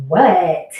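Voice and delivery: punched, exaggerated perky "what"; mock happy. Shown as a demonstration. Worker showing how she won't answer her manager, mock happy. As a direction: overdo an eager, happy reply. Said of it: "being happy".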